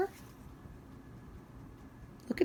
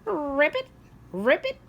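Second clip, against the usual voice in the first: frog croak; 7 semitones higher than usual. Acted.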